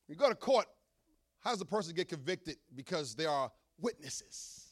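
ordinary talk

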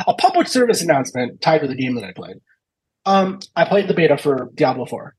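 ordinary talk

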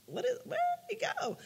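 high, startled "where did he go"; sudden high confused quote; mock alarm in a high voice; alarmed question. Retelling her alarmed reaction.

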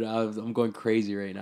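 normal talking voice

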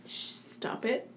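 soft, slow whispered shush; whispered telling-off; hushing whisper. Real, not acted. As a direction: hush them under your breath. Hushing the co-host.

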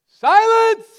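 put-on father stopping the kids' chatter with one yell; dad's silencing shout. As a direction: bark it at noisy kids in the back seat. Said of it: "dad'll get that kind of voice".

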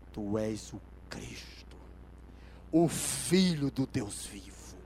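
low, very slow declaration; solemn confession. Acted. Hardened Peter answering Jesus who he is, slow solemn confession. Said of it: "tido como homem duro, olhou pra ele e disse".

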